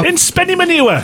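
mock surprise. Joking mispronunciation.

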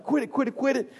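high, rapid-fire mock scolding; frantic scolding mom. Mom to screaming kid in the cart, high frantic scolding.

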